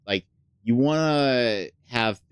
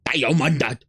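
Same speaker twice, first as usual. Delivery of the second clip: loud, high, drawn-out shout of Diamond; 8 semitones higher, 7 dB louder, much slower than usual. Retold or imitated. Gamer imitating the match announcer, loud shout. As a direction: bellow it like a game announcer. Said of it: "I wanna go".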